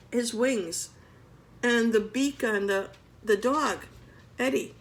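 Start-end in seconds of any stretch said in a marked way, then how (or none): none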